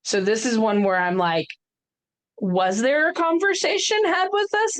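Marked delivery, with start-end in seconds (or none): 2.4-4.8 high-pitched incredulous question; mock questioning. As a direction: question a parent's judgment, voice pitched way up. Mock questioning of parent's choice.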